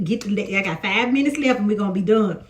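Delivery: usual speaking voice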